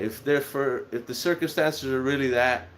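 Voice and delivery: plain reading voice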